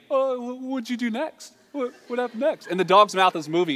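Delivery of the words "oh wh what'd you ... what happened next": high-pitched, eager talking dog; dubbed dog voice. Acted. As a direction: play a hopeful dog, high and halting. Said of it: "He's just doing the voice".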